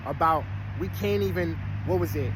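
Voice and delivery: ordinary talk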